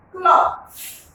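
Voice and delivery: cloth said high and clear for the class; model word. Shown as a demonstration. Teacher modelling a word for pupils, high and clear. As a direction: pitch "cloth" up and hold it clearly. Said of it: "Can you pronounce that word?".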